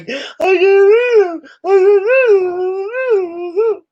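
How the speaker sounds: very high, crying wail; mock wailing disbelief. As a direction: sob it in hammed-up despair. Mocking reader's distraught thoughts.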